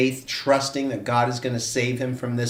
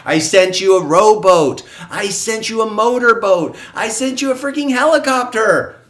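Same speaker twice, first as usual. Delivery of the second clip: rising disbelief, much higher at the end; exasperated God; 9 semitones higher, 8 dB louder than usual. Retold or imitated. God to the drowned man, exasperated: building loud and high.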